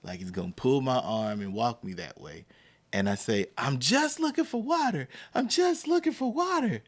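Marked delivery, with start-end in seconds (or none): 3.6-6.8 high-pitched retold plea as a kid; high imitation of a kid insisting; pleading kid. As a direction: plead it high, acting out your younger self. Retells own childhood plea to police.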